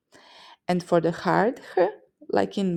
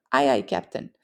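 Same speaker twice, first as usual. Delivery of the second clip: sailor reply; 6 semitones lower than usual. Acted.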